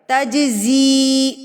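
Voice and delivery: تجزی drawn out very slowly, the z buzzing strong. Shown as a demonstration. Teacher models تجزی with a strong buzzing z. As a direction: lean on the zaa in تجزی. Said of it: "یہ مضبوطی سے ادا ہوتا ہے".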